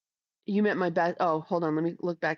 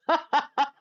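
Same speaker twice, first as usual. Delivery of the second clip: burst of laughter; 6 semitones higher, 3 dB louder than usual. Real, not acted. Laughing at own story.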